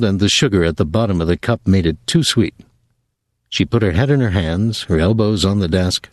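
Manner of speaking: ordinary delivery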